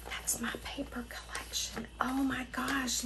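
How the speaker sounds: breathless whisper of amazement; awed whisper. Real, not acted. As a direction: whisper it in awe. Sees her own paper design.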